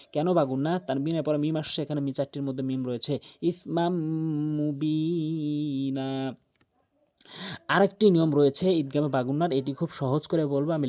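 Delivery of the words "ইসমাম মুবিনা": "ইসমাম মুবিনা" very slow, m hummed between the words; recited with ghunnah. Shown as a demonstration. Teacher models meem after tanween, long hummed "ইসমাম মুবিনা". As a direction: hum the M joining "ইসমাম" to a stretched "মুবিনা". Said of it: "এখানে মাম গুণনা করতে হবে".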